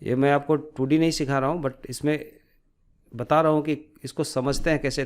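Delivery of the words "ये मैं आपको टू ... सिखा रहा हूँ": ordinary talk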